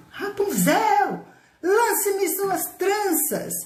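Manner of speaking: slow, high, drawn-out call up the tower; prince calling. Acted. Prince calls to Rapunzel from below, slow and high. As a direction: stretch the call out upward.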